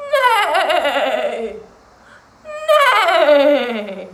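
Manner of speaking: loud, wavering, falling whinny; horse neigh. Shown as a demonstration. Teacher makes animal sounds for kiddos, loud whinny. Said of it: "I'm going to make animal sounds".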